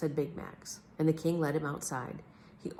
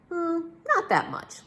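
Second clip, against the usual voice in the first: higher, slow, musing reply; musing detective; 12 semitones higher, slower than usual. A narrator's reading. Detective answering the king's fee question, coy. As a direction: drawl it out coyly.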